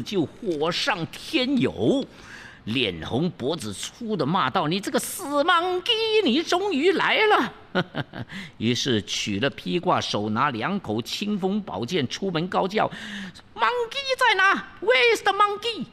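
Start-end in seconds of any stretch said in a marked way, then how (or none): 4.7-7.5 very high, shrieking demoness with a cackle; high shrill princess cursing the monkey; furious princess. Acted. Princess curses Wukong. 7.7-8.5 a short, soft evil cackle; wicked laugh. Acted. Princess laughs. 13.5-15.9 very high, shrill call for the monkey; high demoness hollering Where's the monkey; princess shouting. Acted. Princess calls out at the gate.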